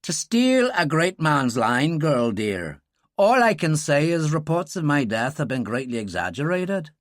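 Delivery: put-on hard Belfast accent, slow and dry. Dylan proving who he is, hard Belfast accent. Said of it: "Dylan dropped into the hard, distinctive accent of Belfast".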